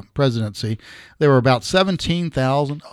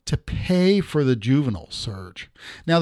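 usual speaking voice

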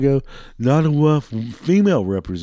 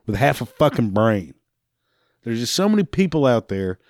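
usual speaking voice

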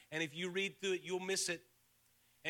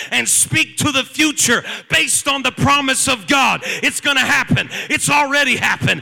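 loud, climbing sermon shout; full-force preaching; 4 semitones higher, 14 dB louder than usual. Real, not acted. Preacher declaring God's promise already fulfilled, full-force preaching. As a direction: drive it home at the top of the voice.

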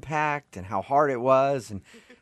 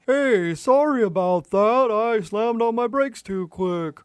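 cordial driver; 10 semitones higher, 3 dB louder, slower than usual. Retold or imitated. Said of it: "nice and cordial".

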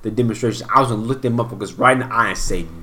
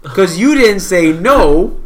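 loud, emphatic telling-off of yourself; worked-up lecture to yourself; self-scolding; 5 semitones higher, 4 dB louder than usual. Retold or imitated. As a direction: berate yourself, punching key words louder and higher. Scolding himself for overspending.

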